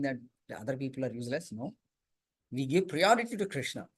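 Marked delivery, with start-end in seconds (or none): none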